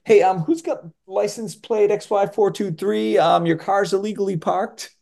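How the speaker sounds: a hint of a bored official paging; mild mock public announcement; lightly voiced official. Voicing a film character.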